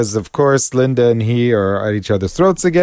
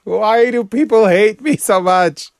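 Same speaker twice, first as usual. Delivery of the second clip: mock despair; 8 semitones higher, slower than usual. Mock self-pity about pockets.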